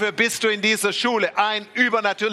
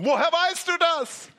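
stunned coworker; 10 semitones higher than usual. Retold or imitated.

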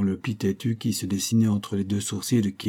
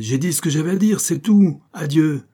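angry, loud and high; growling; 6 semitones higher, 7 dB louder than usual. Acted. Keely refusing to answer Stetson, loud angry growl. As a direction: snarl it and end the talk. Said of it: "gronda-t-il".

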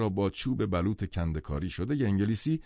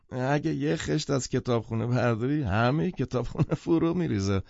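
usual speaking voice

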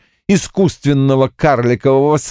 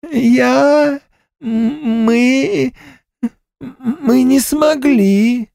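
ghost voice; much slower than usual. Acted. Ghost servant stammering.

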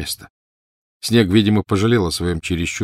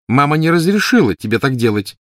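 high, louder big-sister reproach; scolding child; 8 semitones higher, 6 dB louder than usual. A narrator's reading. Petunia telling off her sister on the swings, scolding. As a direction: scold like an indignant little girl.

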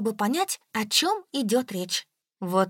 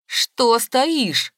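loud, impatient shout; angry cry; 2 semitones higher, 7 dB louder than usual. A narrator's reading. Aunt scolds the narrator for standing idle, loud cry. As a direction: cry it out at the idler. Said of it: "вскричала тётя Галя".

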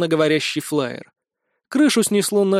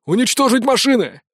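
shouted order; 7 semitones higher, 4 dB louder than usual. A narrator's reading. Mercenary commander orders attack.

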